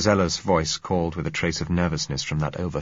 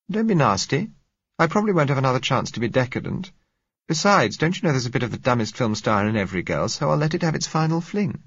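put-on higher, lively young woman teasing the narrator; Josella's voice; 8 semitones higher than usual. A narrator's reading. Josella teasing the narrator about being decadent, lively higher voice. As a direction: play the girl being playfully defiant, a touch higher.

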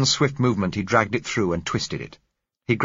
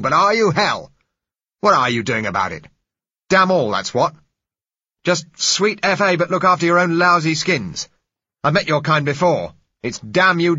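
loud, high, passionate fury; violent rant; 10 semitones higher, 7 dB louder than usual. Acted. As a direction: hammer them with contempt. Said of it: "The man's voice was violent".

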